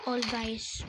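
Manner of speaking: ordinary talk, nothing marked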